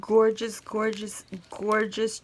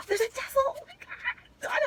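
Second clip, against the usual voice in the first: real little squeal; delighted gasp; 13 semitones higher than usual. Real, not acted. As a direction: caught off guard by a lovely surprise. Finding a tassel in the box.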